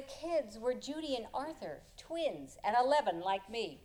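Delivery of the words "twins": usual speaking voice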